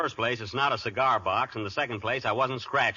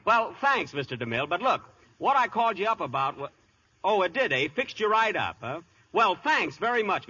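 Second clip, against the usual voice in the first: higher, overly polite thanks; eager phone voice; 6 semitones higher than usual. Acted. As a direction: thank him brightly while hiding disappointment.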